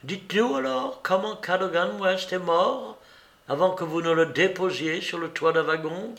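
lightly voiced Holmes, cool and reproachful; Holmes accusing. A narrator's reading. Holmes urging the traitor to confess, coolly reproachful. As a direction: lay out his guilt, measured and cold.

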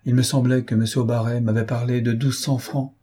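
ordinary delivery, nothing marked

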